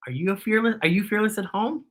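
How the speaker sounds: ordinary delivery, nothing marked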